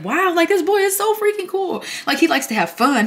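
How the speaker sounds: high, loud, wowed voice; impressed mom. Retold or imitated. Mom meeting Jordan for the first time, high and impressed. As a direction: gush like a delighted mom meeting the boyfriend. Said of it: "And she was like".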